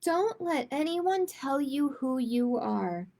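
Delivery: usual speaking voice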